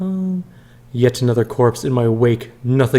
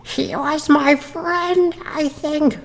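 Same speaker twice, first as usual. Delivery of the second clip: very high, drawn-out thin voice; attempted creature voice; much slower than usual. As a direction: stretch each word slowly, high and strained, like a withered thing in a jar. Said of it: "I'm gonna try to do his voice".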